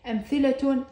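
أمثلة said amthilatun, final tun; nunated t ending. Shown as a demonstration. Teacher showing the spoken t, أمثلة ending in tun. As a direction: end أمثلة on a clear tun. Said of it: "هنا لفظتها تاء".

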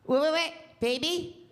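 put-on light cooing baby talk with a code line; hushed phone voice for the girlfriend. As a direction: sweet-talk her, slip the code in. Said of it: "girlfriend voice".

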